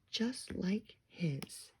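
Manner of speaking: slow, soft and tender; tender reveal. A narrator's reading. Narrator revealing the baby, soft and slow. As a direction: savor the surprise gently.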